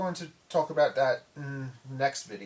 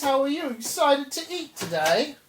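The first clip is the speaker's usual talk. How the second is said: cooing to pet snake; 9 semitones higher, 6 dB louder than usual. Real, not acted. Talking sweetly to pet snake.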